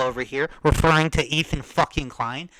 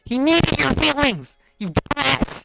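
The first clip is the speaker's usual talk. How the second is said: loud, higher furious shout; real angry yell; 9 semitones higher, 7 dB louder than usual. Real, not acted. Losing his temper at the boxer, furious shout. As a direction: blow up at him. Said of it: "is getting me pissed off".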